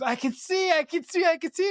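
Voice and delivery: high, delighted mock cry of wonder; a blind man amazed he can see, overjoyed; Blinken's joyful cry. Retelling Blinken getting up from his fall, mock overjoyed and high. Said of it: "he goes".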